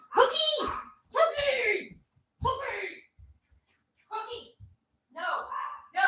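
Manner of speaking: high calling out for the dog. Real, not acted. Owner calling her runaway dog, high and fading. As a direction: call her name across the house, fading.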